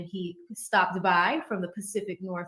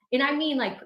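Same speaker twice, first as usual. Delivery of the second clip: ordinary talk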